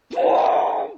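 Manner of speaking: loud, drawn-out "boom"; imitated boom. Tired teen flooring the gas, loud imitated boom. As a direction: punch out a booming take-off noise.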